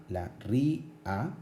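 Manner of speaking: "ri, a" as two detached syllables; separated vowels. Shown as a demonstration. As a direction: pause between "ri" and "a". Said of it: "se pronuncian en vocales diferentes".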